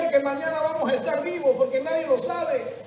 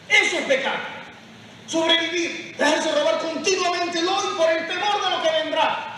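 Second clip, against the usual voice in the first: loud, high preaching shout; preaching at full force; 5 semitones higher, 3 dB louder than usual. Acted. Priest urging the congregation not to fear, shouting.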